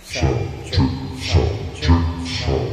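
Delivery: devil voice changer, very low; deep pitch shifted. Shown as a demonstration. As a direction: speak through a demonic pitch shifter. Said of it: "Naging demonyo". (boses demonyo sa voice changer)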